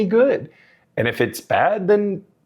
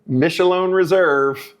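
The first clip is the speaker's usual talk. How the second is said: slow announcer voice; 6 semitones higher, much slower than usual. Acted. Formal product intro.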